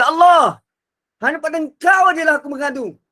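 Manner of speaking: high, loud wailing plea; emotional outcry. Shown as a demonstration. A wife crying out her complaint to God. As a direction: cry it out to God, fast and loud. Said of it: "Boleh juga dibaca begini".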